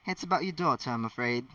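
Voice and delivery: usual speaking voice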